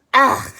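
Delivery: loud high disgusted cry; disgusted ugh. Acted. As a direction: recoil from a cow's nose. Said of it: "cried Annalisa".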